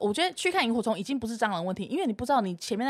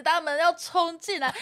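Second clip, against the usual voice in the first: retold crying panic; 7 semitones higher than usual. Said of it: "然后我就是哭".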